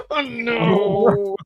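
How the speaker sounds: high, slow laugh through words; laughing in disbelief. Real, not acted. Laughing at an ugly kit.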